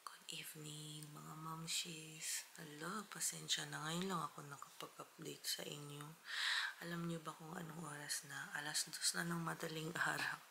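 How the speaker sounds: soft late-night whisper. Real, not acted. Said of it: "mahina yung boses ko kasi tulog na yung mga bata". (pabulong na boses)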